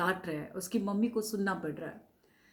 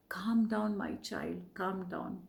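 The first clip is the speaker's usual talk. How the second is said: put-on soft, hushed comfort to a child; tender soothing; 5 dB quieter, slower than usual. Mother holding her shivering son, softly soothing. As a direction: soothe a frightened child gently. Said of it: "she said that".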